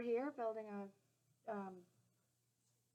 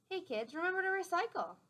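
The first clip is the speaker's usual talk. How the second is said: cheery call; 8 semitones higher, 7 dB louder than usual. Acted. Garbage man calls to kids.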